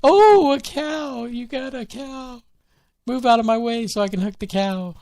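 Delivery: very high squealed mock excitement; hammed-up high-pitched delight over a surprise; imitating excited partner. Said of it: "she'll like".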